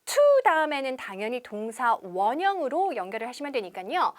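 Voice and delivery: usual speaking voice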